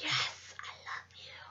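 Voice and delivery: breathless whispered delight. Retold or imitated. Gazing at the huge bag, a breathless whisper. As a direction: whisper it like a secret love confession. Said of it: "And I was like".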